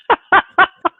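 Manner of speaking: bursting high laugh; big laugh at his own punchline; loud laugh. Real, not acted.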